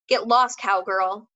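put-on loud snarl at a smaller kid. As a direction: bark it out as a threat. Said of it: "he snarled at Roy".

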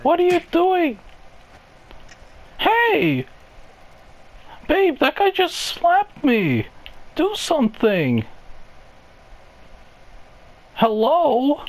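put-on high, outraged girly voice; high whiny girlfriend. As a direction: shriek for the boyfriend. Girlfriend slapped, calling boyfriend.

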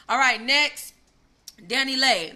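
loud, high call to next topic; loud announcing. Real, not acted.

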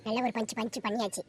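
ordinary talk

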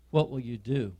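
normal talking voice

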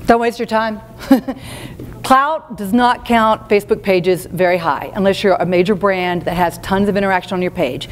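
ordinary talk, nothing marked

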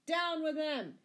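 high, loud yell to attack; shouted command. A narrator's reading. Sheriff setting the crowd on Robin, shouting. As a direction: shout your men into action.

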